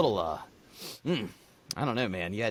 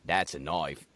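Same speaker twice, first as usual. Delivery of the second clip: ordinary delivery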